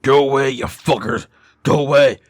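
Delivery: loud furious shouting. A narrator's reading. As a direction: scream it through the door. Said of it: "shouting at them".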